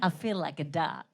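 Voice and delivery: soft, low, lazy murmur of longing; cigarette craving. Acted. Comic acting out the start of a cigarette, low soft murmur. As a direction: mutter it low to yourself. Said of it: "the beginning of the story is".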